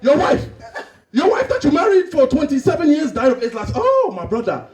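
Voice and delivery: hammed-up disbelief in an African accent. Friend hears of wife's death, shocked African accent. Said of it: "Two Africans that haven't seen each other".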